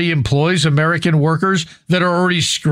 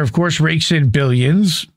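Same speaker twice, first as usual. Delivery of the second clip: usual speaking voice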